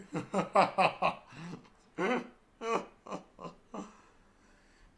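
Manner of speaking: loud, high burst of real laughter; cracking up at the text; long laugh. Real, not acted. Reader bursting into laughter at the story.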